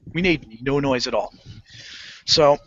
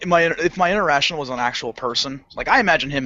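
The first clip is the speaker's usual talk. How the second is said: ordinary talk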